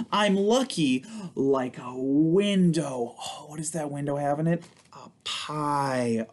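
slow, playful picture-book read; reading to children. Acted. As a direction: read it aloud to little ones with big expression. Reads picture book to kids.

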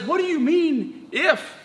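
high, slow, questioning disbelief; Jesus's incredulous reply. Acted. Jesus answering the doubting father's plea, slowly incredulous. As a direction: draw the question out high, landing on "if". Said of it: "Jesus responds and says".

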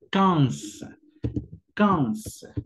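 "quinze" with a nasal kan and a final z; French quinze. Shown as a demonstration. Teacher showing students how to say quinze. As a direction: say "quinze" as kanz. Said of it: "la manera en que lo vas a pronunciar es".

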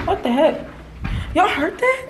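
high startled question. Real, not acted. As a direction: jump at the noise and ask. Startled by elevator noise.

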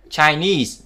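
"Chinese" stressed on -nese; careful teaching "Chinese", second syllable stressed. Shown as a demonstration. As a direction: put the weight on the end of "Chinese". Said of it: "nhưng mà knees luôn".

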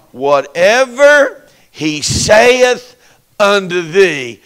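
slow, loud, every word laid down; solemn, slow KJV delivery; slow command. Acted. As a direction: drag it out with authority.

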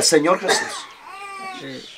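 soft and very slow, almost murmured; quiet prayer. Shown as a demonstration. Pastor modelling a hushed prayer for the man, quiet and slow. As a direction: breathe it out gently like a whispered prayer. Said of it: "oran quedito".

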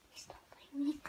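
unvoiced hiding whisper; whispering while hiding. Real, not acted. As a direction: breathe the words, don't voice them.

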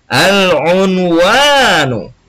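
Al-Unwanu stretched out very slowly, each syllable drawn long; model word to repeat. Shown as a demonstration. Teacher leading pupils in repetition, drawn-out slow model word. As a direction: say Al-Unwanu slowly so the class can echo it. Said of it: "Sama-sama ikuti Bapak".

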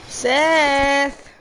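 shouting a name. Real, not acted. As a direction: yell the name across the room. Calling out to a friend.

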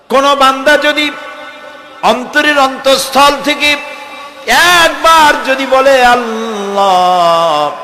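high sermon cadence swelling into a long-held last word; fervent preaching. Real, not acted. Preacher on calling God from the heart, high drawn-out cadence. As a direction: raise the pitch, then hold the final vowel.

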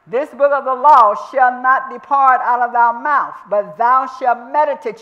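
loud preaching. Real, not acted. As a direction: thunder the verse out to the congregation. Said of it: "You're gonna mutter".

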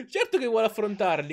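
laughing while speaking; amused laugh in the voice. Real, not acted. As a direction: say it through a laugh. Laughing at character's eagerness.

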